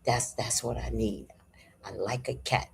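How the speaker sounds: the speaker hushed, answering John softly. Retold or imitated. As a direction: say it softly, half to yourself. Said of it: "And I was like".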